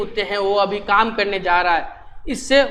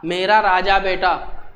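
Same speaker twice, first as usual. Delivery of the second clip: lower, slow and tender; loving mother; 4 semitones lower than usual. Acted. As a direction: coax him gently like a mother soothing her son. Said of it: "माँ ने प्यार से लड़के को बोला".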